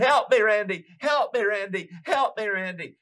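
high, tearful mock plea for help; acted-out desperate crying; imitated crying plea. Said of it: "and he's crying".

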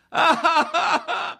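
high, loud imitation bawling; mock boo-hoo wailing. Preacher imitates John weeping in the church, mock bawling. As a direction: wail in exaggerated, pulsing sobs. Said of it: "He's boo-hooing like".

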